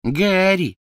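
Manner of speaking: high, loud mocking echo; mocking imitation. Crabbe mimics Ron's cry, high and loud in mockery. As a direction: parrot Ron to taunt him. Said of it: "передразнил Крэбб".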